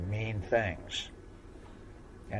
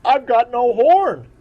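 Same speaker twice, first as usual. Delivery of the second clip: high, loud tearful wail; little unicorn crying about his missing horn; 17 dB louder than usual. Acted. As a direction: whimper it like a child about to cry. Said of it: "started to cry a little".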